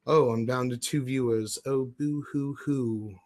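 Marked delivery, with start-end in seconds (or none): 1.6-3.1 slow, put-on boo hoo; mock boo-hooing. Streamer down to two viewers, mock boo-hooing. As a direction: pretend to weep, drawn out.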